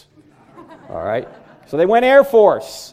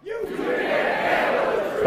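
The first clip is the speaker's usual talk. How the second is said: much higher, slow, breathy-edged growl of the colonel; Jack Nicholson impression; 7 dB quieter, slower than usual. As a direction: hurl it back like the colonel on the stand. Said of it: "That's much better".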